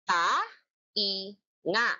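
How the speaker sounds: syllables split by pauses; spaced-out syllables; clapped syllables. Shown as a demonstration. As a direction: beat out each syllable. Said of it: "Ipalakpak nyo nga".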